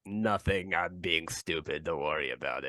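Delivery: low, quiet, slowing retreat; small, subdued self-dismissal; meek backing down. Acted. Character backs down after being challenged.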